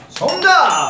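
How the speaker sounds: loud excited correct call; game show host. Acted. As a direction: shout it like a quiz host. Host declares correct answer.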